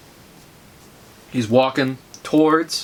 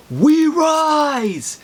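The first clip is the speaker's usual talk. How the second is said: high, drawn-out acted war cry; battle scream; 10 semitones higher than usual. Thomas Wayne charging into the nothingness, acted scream. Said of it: "and he screams".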